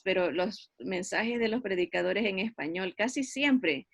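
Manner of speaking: usual speaking voice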